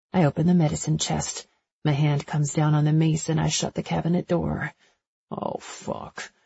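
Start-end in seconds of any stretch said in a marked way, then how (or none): 5.3-6.3 barely voiced, under the breath; murmured. A narrator's reading. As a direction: mutter it almost silently. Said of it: "Asher murmurs".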